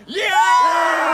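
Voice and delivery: big loud yeah cheer; cheering shout. Acted. Protester cheering loudly with the crowd.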